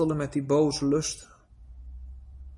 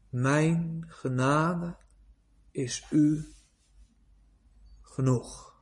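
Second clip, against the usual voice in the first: slow, hushed, weighty words of God; slow solemn God; 3 semitones lower, 3 dB quieter, much slower than usual. Acted. Preacher giving God's reply to Paul, slow and solemn. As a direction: let each word land, calm and final.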